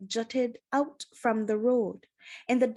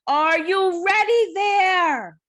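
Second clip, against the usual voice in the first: much higher, louder, slow call across the valley; loud shout; 10 semitones higher, 9 dB louder, slower than usual. A narrator's reading. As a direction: bellow it across the dark valley. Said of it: "A loud voice shouted".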